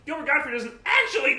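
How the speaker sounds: loud, high, shrill Gottfried squawk; Gilbert Gottfried voice. Retold or imitated. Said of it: "I think he's like Gilbert Gottfried".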